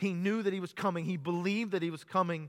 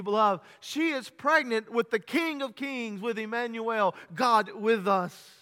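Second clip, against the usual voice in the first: fiery preaching; 4 semitones higher than usual. Acted. Preacher telling the congregation of the angel, fiery preaching. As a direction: preach it out to the whole church.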